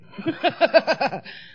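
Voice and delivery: high loud laugh; big laugh. Real, not acted. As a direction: burst out laughing. Laughs at own story.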